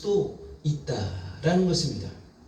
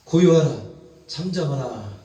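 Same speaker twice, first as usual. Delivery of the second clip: Jesus booming a command, louder, falling at the end; loud command to the sea; 4 dB louder than usual. Acted. Preacher voicing Jesus to the storm: loud command. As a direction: boom it out over the storm. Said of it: "바다를 향해서 말합니다".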